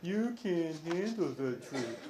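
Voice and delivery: slow, soft, deflated delivery; weak Nicholson impression. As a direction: say it like it doesn't matter. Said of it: "I don't think he said it like that".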